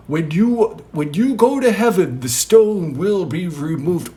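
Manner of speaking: high, loud pronouncement; acted preacherly declaration; proclaimed moral. Jesus proclaims a draft moral.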